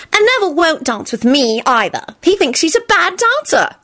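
put-on high pouting little girl. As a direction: complain like a small girl. Rosie complaining.

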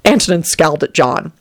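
ordinary delivery, nothing marked

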